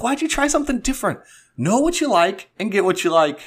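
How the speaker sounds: high, fast exasperated scold. Retold or imitated. Boyfriend scolds girlfriend for ordering something new, high fast exasperation. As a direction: fire it off in disbelief at her. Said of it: "I was like".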